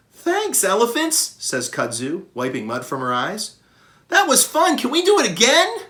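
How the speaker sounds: put-on high, loud, bubbly child gorilla voice; a thrilled little kid after a ride. Baby gorilla Kudzu thanking the elephants, high and thrilled.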